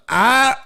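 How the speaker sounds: high open "ah" as the cat; imitated cat gag. Cat finally releasing lizard, high imitated cry. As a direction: imitate a cat spitting something out. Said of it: "then finally he goes".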